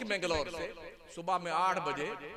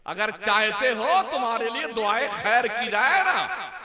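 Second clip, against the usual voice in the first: loud, high fiery sermon; preaching at full force; 4 semitones higher, 8 dB louder than usual. Acted. Preacher urging listeners to do good, in a fiery loud call. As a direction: thunder the call to do good deeds.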